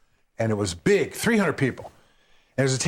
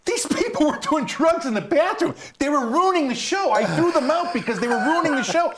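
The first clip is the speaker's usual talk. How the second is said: high, pleading protest; 14 semitones higher than usual. Retold or imitated. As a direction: plead with the crowd. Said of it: "I said".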